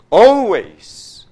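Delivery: preacher's loud cry; emphatic shout. Acted. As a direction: ring out the word Always. Preacher hammers key word.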